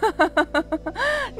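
short scornful laugh; sister-in-law laughs at Nazan's idea of a room; mocking laugh. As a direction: laugh her request off. Mocking sister-in-law's request.

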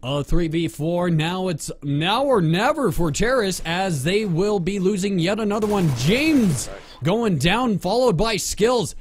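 esports play-by-play with swooping emphasis; sports announcer. Pretend. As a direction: call the round like a sportscaster. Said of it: "tell me you're a sports announcer".

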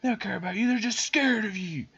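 high-pitched mock villainess; hammed-up high wicked manipulator; evil lady. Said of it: "the evil lady starts to lose her grip".

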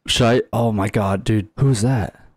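normal talking voice

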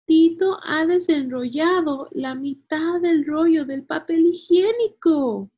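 high, loud storybook outcry; loud, high-pitched tattling girl; shouted complaint. A narrator's reading. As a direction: cry out the puppy's mischief in dismay. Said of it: "gritó Julia".

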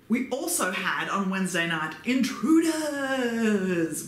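long, drawn-out hammed-up call; mock-dramatic announcement. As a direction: announce it like a dramatic show twist. Announcing the show's intruders with mock drama.

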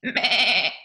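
loud, wavering bleat; goat bleat. Acted. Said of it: "and goats go".

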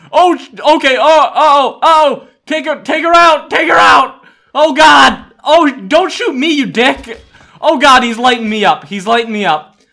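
loud, high yelps of alarm; panicked yelling. Real, not acted. Player being shot by own teammate, panicked yelling. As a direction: yell as if under fire.

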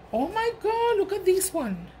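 high, squealed oh my God; delighted squeal. Real, not acted. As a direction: squeal with joy at the find. Delight at new gift.

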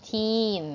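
put-on TEEN held out with a long "ee". Shown as a demonstration. Teacher telling pupils the double e is long, drawn-out teen. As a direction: stretch TEEN slowly. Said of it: "ออกเสียงยาว".

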